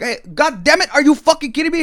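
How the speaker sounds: high, loud cursing; retold angry outburst; imitated exasperated cook. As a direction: swear at him in disbelief. Kitchen guy in acted quarrel.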